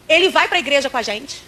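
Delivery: ordinary talk, nothing marked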